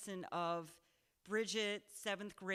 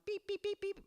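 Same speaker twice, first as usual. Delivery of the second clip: high, even electronic beeps; imitated phone beeps; 12 semitones higher than usual. Office phone showing waiting messages, high imitated beeping. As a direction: beep like a flashing message light. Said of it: "the red dot, you know".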